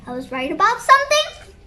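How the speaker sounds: quick question, rising at the end; acted surprise, lifting on the last word; surprised question. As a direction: ask it in happy disbelief. Kid surprised to be right.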